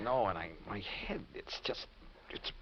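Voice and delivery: faint whispered words, barely there; weak whisper. Acted. Dazed crash victim telling Al his head hurts, whispering.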